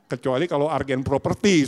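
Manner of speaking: ordinary talk, nothing marked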